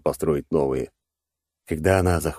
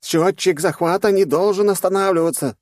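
high, loud furious roar; 14 semitones higher, 4 dB louder than usual. Acted. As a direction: bellow the orders. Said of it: "взревел советник королевы".

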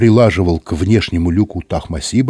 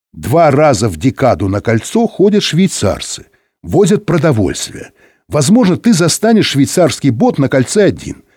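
higher-pitched, brisk official giving orders; radio voice of the director; 6 semitones higher, 3 dB louder than usual. Acted. (голос директора по рации, повыше)

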